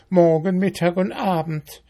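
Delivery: high, brisk ant voice; scornful ant. A narrator's reading. Ant quoting mayfly to beetle, scornful.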